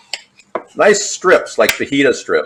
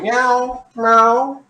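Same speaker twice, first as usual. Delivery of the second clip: high mock meows, rising at the end; imitating the cat; 11 semitones higher than usual. Mocking his cat's meowing, high mock meows. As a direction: copy the cat's nagging meow. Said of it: "She keeps going".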